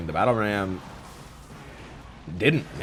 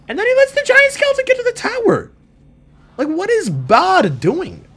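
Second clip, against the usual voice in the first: exasperated rant; 7 dB louder than usual. Real, not acted. Gamer ranting at opponent Bod, high and loud.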